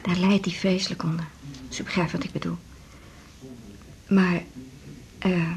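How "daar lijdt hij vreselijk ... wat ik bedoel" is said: hushed and confidential; discreet whisper. Acted. Discreet talk of prostate trouble.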